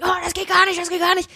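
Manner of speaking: high-pitched mock indignation; imitated instant outrage. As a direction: jump down their throat in a high, parodying voice. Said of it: "die gleich sofort anspringen". (hoch nachgeäffte sofortige Empörung)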